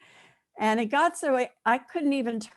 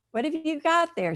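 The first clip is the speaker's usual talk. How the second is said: mother asking; 7 semitones higher than usual. Acted. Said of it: "she asked".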